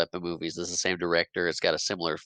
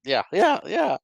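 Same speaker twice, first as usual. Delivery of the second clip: cracking high voice; 5 dB louder, slower than usual. Real, not acted. Teased about puberty, answering in a cracking high voice. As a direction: voice jumps much higher and louder, laughing. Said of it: "And, and go through puberty?".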